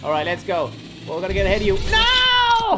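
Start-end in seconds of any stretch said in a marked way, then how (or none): 1.9-2.8 shocked shout. Real, not acted. Opponent knocks his disc away.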